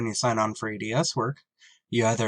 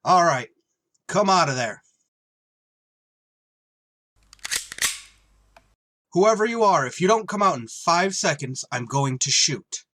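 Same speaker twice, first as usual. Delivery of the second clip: threatening shout; 3 semitones higher than usual. Acted. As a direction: order them out, gun drawn. Pilot threatens hidden stowaway.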